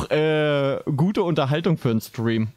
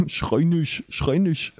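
lightly mimicked telling-off between laughs; mock "don't shout", repeated for laughs; mocking imitation; 2 semitones higher, much slower than usual. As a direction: parrot the boy's refrain, amused. Mimics boy from the played clip.

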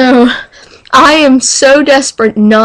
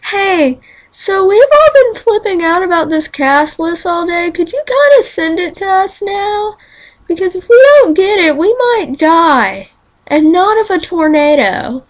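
mock pleading; 8 semitones higher than usual.

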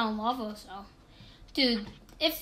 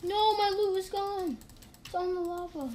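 dismayed; 8 semitones higher than usual. Real, not acted. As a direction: lament the lost loot. Loot lost.

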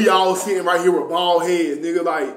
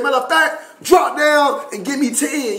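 high-pitched mock command; retold bark, pitched well up; yelling drill sergeant; 7 semitones higher than usual. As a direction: order push-ups as an imitated sergeant. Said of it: "little dude that was yelling".

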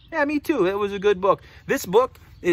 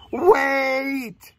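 long held, raised "wait"; snake character; 5 semitones higher, 3 dB louder than usual. Acted. Snake stops the friends, drawn-out raised protest. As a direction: call a halt, stretching the word. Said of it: "The snake says".

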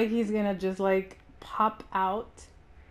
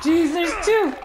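loud, high startled exclamation; alarmed yell; 8 semitones higher, 8 dB louder, slower than usual. Real, not acted. Player spots two enemies at once, alarmed yell.

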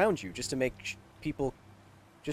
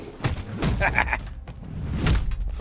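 giggle; 4 semitones higher than usual. Real, not acted. Laugh after invisibility joke.

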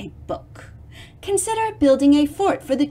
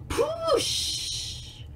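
whooshing sound; 9 dB quieter than usual. Acted. Water sound effect, whispered high.

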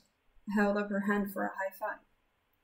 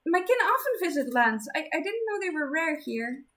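higher, embarrassed stammer; blushing shy girl; flustered girl voice; 8 semitones higher, 3 dB louder than usual. Acted. Embarrassed Zenith.

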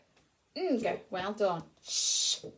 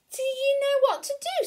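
Dizzy Duck; 6 dB louder than usual. Acted. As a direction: invite the ducklings along. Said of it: "said Dizzy".

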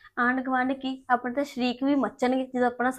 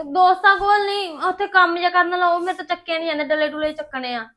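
raised, louder protest; 6 semitones higher, 7 dB louder than usual. Acted. Sister stops brother going off to his friends, protesting. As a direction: object in a higher, louder voice.

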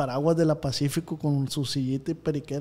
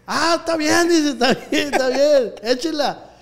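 imitating the lady; 10 semitones higher, 7 dB louder, slower than usual. Voicing the lady giving in.

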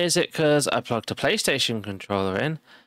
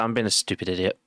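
ordinary talk, nothing marked

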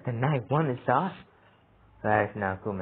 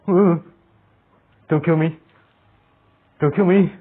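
scared pleading; 5 semitones higher, 7 dB louder than usual. Real, not acted. Begging monster in horror game.

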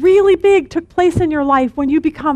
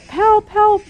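high, squeaky little cry for help; panicked crow; 8 semitones higher than usual. Retold or imitated. Preacher voicing a crow pinned by a bear, squeaky and panicked. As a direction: voice a tiny bird calling out for help.

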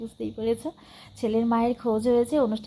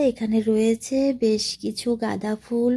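usual speaking voice

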